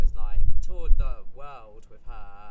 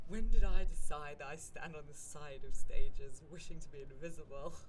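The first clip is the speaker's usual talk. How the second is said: dramatic realisation; 3 dB quieter than usual. Retold or imitated. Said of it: "such a dramatic writer-actor line".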